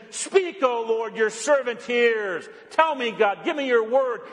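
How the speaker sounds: high, slow, ringing plea; fervent prayer. Acted. Pastor crying the prayer to God, high and fervent. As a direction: cry out Speak up high.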